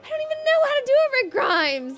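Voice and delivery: high, loud exasperated outburst; exasperated protest. Real, not acted. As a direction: protest loudly at being pushed into it. Pestered to do an impression.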